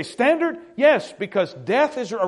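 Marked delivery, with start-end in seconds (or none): 0.0-0.6 incredulous question. Retold or imitated. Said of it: "You say".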